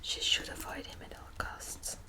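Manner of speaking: normal talking voice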